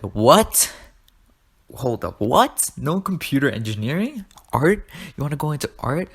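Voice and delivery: sharp rising "what?", scandalised and dismissive; incredulous dad. Retold or imitated. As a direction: imitate a father outraged by the news. Dad's shocked rejection, acted out.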